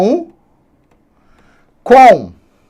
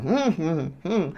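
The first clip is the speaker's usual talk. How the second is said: very soft closed-mouth hums; thinking hum; 14 dB quieter, slower than usual. Shown as a demonstration. Student silently thinking out the syllables, humming softly. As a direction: hum it quietly to yourself. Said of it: "A gente pensa".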